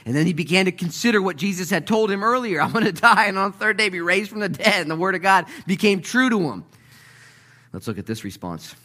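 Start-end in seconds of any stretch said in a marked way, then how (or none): 0.1-6.6 high, loud, driving sermon delivery; full-force preaching. Real, not acted. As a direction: press the congregation with raised voice.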